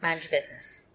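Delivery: sleepy soft grumble; mumbled. Real, not acted. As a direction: mutter it again, half awake. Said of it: "you're still yawning".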